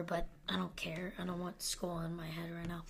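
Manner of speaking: hushed aside under the breath; whisper. Acted. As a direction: whisper it like a confession. Joking aside about rounding.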